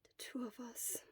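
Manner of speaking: breathy sick whisper, barely there; fading words of someone feverish; weak whisper. Acted. Shota whispers painfully.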